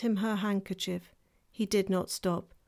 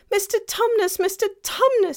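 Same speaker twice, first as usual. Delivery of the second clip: high, loud, pleading calls; great distress; 12 semitones higher, 7 dB louder than usual. A narrator's reading. Lucy begging the weeping faun, high distressed calls. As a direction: plead with a crying friend. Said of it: "in great distress".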